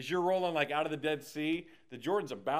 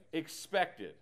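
ordinary delivery

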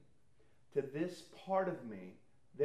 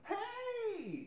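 high, bright burst of a "Hey!"; perky greeting. Retold or imitated. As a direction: flip on like meeting a friend. Greeting someone, switching on.

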